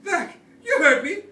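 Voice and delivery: high, loud commanding yells; shouting to keep a dinosaur back; lion tamer shout. Acted. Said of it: "he shouted like a lion tamer".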